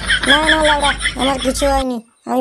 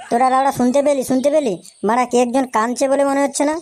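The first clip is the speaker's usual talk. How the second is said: normal talking voice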